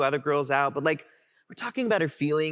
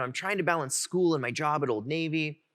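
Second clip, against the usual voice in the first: ordinary talk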